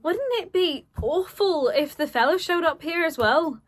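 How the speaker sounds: high, lilting Scouse character line; acted line in a sing-song Scouse accent. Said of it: "I'm doing the accent".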